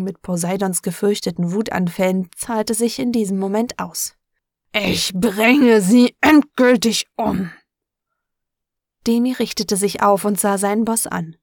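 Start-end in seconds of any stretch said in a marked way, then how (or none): 4.7-7.5 slow, loud, seething threat; furious threat. Acted. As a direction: spit out a deadly vow. Furious boss vows revenge.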